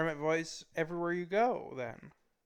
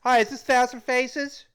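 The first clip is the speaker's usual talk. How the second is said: higher, louder phone greeting; pretend phone caller; 8 semitones higher, 8 dB louder than usual. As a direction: play a caller ringing up, pitched up and loud. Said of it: "or I call you, I go".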